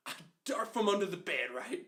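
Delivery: ordinary talk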